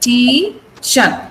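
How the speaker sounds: Pa, ti, shan as three separate beats; syllable by syllable. Shown as a demonstration. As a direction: break partition into its syllables. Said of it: "three syllables in this word".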